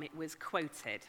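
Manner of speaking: ordinary delivery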